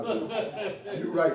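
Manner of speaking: worked up, owning it with a laugh; getting excited. Real, not acted. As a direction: concede it, excited and half laughing. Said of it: "'cause I'm getting excited".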